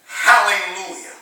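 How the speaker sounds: ordinary talk, nothing marked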